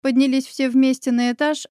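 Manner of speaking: ordinary delivery, nothing marked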